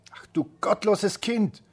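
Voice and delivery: high, loud and slow with outrage; witch crying out. Acted. The witch, catching Rapunzel's slip, cries out in a high shout. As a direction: shriek it like a scandalised witch. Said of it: "rief die Zauberin".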